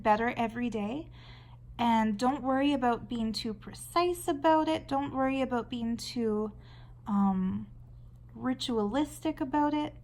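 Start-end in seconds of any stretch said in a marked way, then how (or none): none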